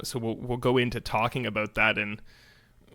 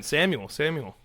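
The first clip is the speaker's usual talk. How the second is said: higher, distant calling voice; lightly voiced divine call; calling voice of God; 4 semitones higher, 3 dB louder than usual. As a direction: call out like a voice from afar. God calling Samuel in story.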